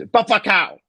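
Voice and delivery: high, retold yell; mock scream. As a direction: imitate someone screaming it out. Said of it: "he screams".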